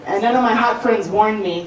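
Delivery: usual speaking voice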